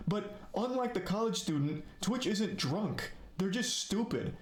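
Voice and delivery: getting heated at the company. Real, not acted. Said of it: "I'm getting heated".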